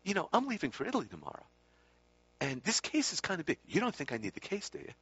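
high, quick, hopeful wheedle; retold Swami's wheedle. Swami angling to leave the case behind, high-pitched coaxing. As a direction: imitate him fishing for agreement in a high voice. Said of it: "Exactly the same inflection even".

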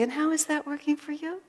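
high, sweet rising question; sweetly. Retold or imitated. Healer sweetly questioning the speaker's stinky attitude. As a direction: ask it as tenderly as you can. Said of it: "She said it so sweetly".

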